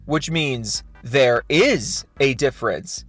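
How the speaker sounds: slow, leaning hard on "is"; drawn-out "there IS a difference"; stress on is. Real, not acted. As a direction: land on the word "is" to spring the trap. Pointing out Randy's contradiction.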